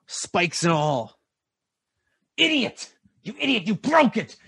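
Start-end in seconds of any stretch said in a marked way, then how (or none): 2.4-4.3 much higher shrieking insults; yelling tiefling. Acted. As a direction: scold the clumsy devil, pitched way up. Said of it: "yelling at a bone devil".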